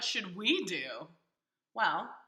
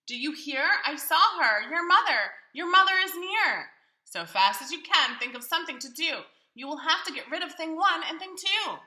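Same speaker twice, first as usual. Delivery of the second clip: higher and louder alarm; panicky fish; 6 semitones higher, 7 dB louder than usual. Acted. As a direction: warn them breathlessly.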